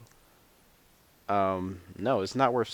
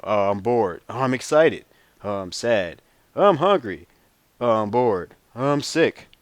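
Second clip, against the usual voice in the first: mock whining; 3 semitones higher, 7 dB louder than usual. Mocking how people whine.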